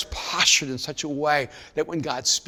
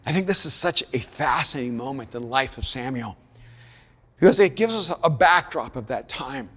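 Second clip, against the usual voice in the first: ordinary talk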